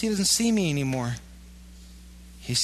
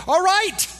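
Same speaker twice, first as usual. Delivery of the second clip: high, loud cry of giving in; a loud, high cry of finally giving up; high surrender cry; 6 dB louder than usual. Retold or imitated. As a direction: throw up your hands with a loud, high "all right".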